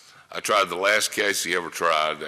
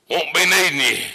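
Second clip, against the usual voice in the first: higher, louder imitated old-lawyer brush-off; old lawyer dismissing; 10 semitones higher, 7 dB louder than usual. Old lawyer telling his witness he's not needed, imitated curtly. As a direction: wave him off like a crusty old attorney.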